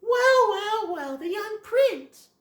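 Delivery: high, slow, mocking villain greeting; sneering villain. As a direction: drag it out like a smug bully. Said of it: "Ronald's all like".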